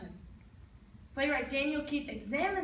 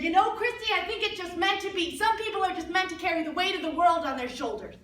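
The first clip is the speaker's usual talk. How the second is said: mother's voice; 7 semitones higher, 6 dB louder than usual. Retold or imitated.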